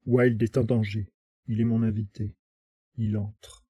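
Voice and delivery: sinister Cardwell ordering the butler aside; cold spat order. Acted. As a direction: spit the order out coldly. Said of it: "il cracha".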